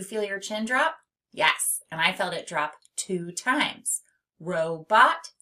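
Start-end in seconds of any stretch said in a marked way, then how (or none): 4.4-5.3 "Robot" as two firm beats, louder; "Robot" sounded out syllable by syllable; split into syllables. Shown as a demonstration. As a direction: punch out "Robot" as ro, bot. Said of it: "two syllables".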